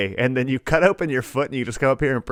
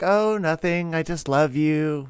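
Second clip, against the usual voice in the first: put-on high, slow sugary child voice; imitating a sweet child; 6 semitones higher, slower than usual. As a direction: act innocent and sweet. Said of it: "And she's like".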